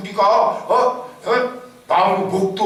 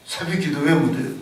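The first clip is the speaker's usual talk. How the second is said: ordinary talk, nothing marked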